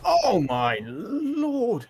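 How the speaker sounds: very slow, high "oh my Lord"; drawn-out dismay. Real, not acted. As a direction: stretch it out in dismay. Dismay at a big knockout.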